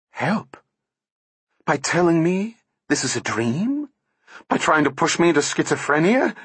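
high, tense disbelieving outburst; hysterical anger. A narrator's reading. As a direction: fling it back at him, near hysteria. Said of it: "A hysterical tenseness seized her".

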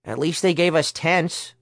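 lightly voiced, hopeful character; a touch of optimism in a character voice; optimistic. A narrator's reading. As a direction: find the small upside, mildly cheerful. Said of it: "Herschel said optimistically".

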